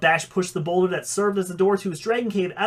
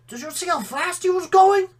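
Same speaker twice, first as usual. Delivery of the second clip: put-on much higher excited child voice; excited little kid; 11 semitones higher, 4 dB louder than usual. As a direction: gush like a small fan. Little mini dragon talks.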